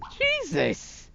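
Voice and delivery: squeaky high exasperated cry. Acted. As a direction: yelp it out in disbelief. Son exasperated at mother.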